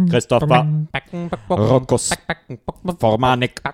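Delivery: emotionless robot voice, word by word; spaced-out robotic monotone. Pretend. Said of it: "So emotionslose Roboterstimme".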